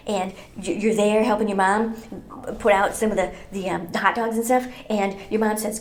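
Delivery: normal talking voice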